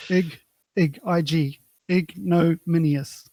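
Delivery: "ignominious" drawn out slowly, syllable by syllable; slow careful pronunciation. Shown as a demonstration. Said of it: "spelling out the word slowly".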